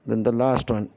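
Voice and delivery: ordinary delivery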